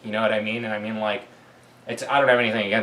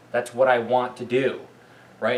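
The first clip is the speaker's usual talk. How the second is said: usual speaking voice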